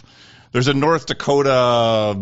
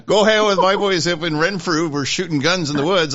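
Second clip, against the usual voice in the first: put-on Ottawa Valley accent; 7 semitones higher than usual. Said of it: "it's pretty strong up there".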